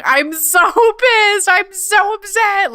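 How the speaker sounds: high, slow, hammed-up whine through a laugh; mock upset. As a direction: overplay the outrage while laughing at yourself. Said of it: "I was like".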